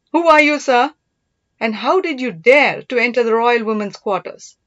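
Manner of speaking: loud, sharp angry challenge; angry demand. Acted. Princess confronting an intruder in her quarters, loud and angry. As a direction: demand to know who he is, loudly. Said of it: "Her surprise was replaced by anger".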